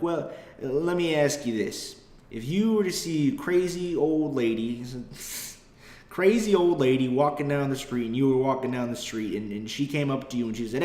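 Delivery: mock rural Southern accent; country cop accent. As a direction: spin a folksy hypothetical like a Southern cop. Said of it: "heavy, stupid country accents".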